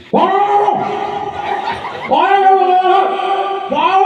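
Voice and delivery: high drawn-out "wow" howl; mock cry. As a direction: throw out separate high wailing cries. Comic imitated cries. (teriakan tiruan bernada tinggi)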